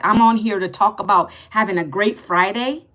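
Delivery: ordinary talk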